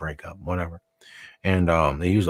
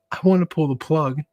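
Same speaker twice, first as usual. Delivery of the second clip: higher mock grief, half-joking; mock tearful son; 8 semitones higher than usual. Son joking to nurse about pulling the plug, higher mock grief. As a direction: fake a grieving son to the nurse. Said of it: "And I'm like".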